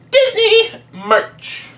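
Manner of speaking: high, slow sarcastic squeak; mock squeak aside. Real, not acted. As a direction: squeak it out like a joke at Disney. Sarcastic aside about toys.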